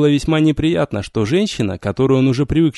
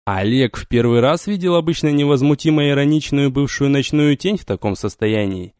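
ordinary delivery, nothing marked

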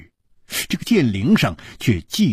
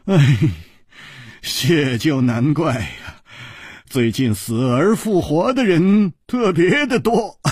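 amused reply rising into a chuckle; laughing; 3 semitones higher than usual. Acted. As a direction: tease along with the joke, then laugh. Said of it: "楚留香笑着说".

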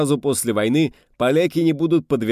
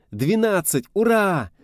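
high joyful cheer; new year cheer; 11 semitones higher, slower than usual. A narrator's reading. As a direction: shout hooray at midnight. Midnight toast, cheering.

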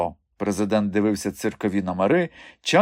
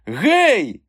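high, loud "hey"; protesting yell; 11 semitones higher, 6 dB louder than usual. A narrator's reading. Mikha hoisted by the collar, kicking, protesting yell. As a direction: yelp out in protest at being grabbed. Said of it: "брикнувся в повітрі Міха".

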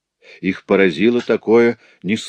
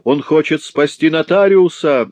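much higher, louder frenzied cry; frantic shout; 9 semitones higher, 5 dB louder than usual. Acted. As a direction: scream it like a man gone mad. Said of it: "отвечал обезумевший гранильщик".

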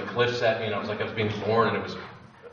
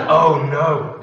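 loud, higher "Oh, no"; anguished cry; 5 semitones higher, 10 dB louder than usual. Retold or imitated. Unable to face what he has done, loud anguished cry. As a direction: cry it out in dread. Dread at facing himself.

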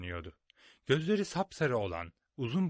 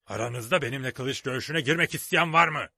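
loud pirate challenge roaring; 3 semitones higher, 8 dB louder than usual. A narrator's reading. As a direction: roar the challenge at the crew. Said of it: "diye kükredi".

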